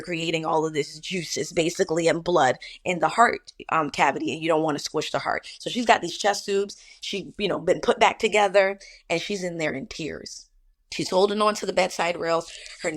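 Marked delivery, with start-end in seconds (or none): none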